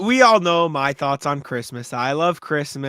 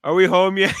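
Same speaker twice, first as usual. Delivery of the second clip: put-on louder, higher drunken question; 4 semitones higher, 6 dB louder than usual. Drunk friend arriving while roommate sleeps, too loud. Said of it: "couldn't keep his voice down".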